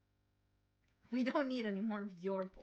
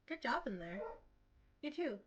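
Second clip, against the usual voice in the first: ordinary delivery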